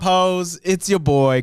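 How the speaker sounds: normal talking voice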